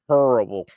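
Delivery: Terrible stretched and leaned on hard; emphatic terrible. Shown as a demonstration. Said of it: "I always say terrible like that when I'm trying to emphasize it".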